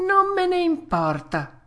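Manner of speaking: high-pitched stubborn retort; defiant puppet. Acted. Puppet defying the fairy's warning, high and stubborn. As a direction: brush it off in a high child's voice.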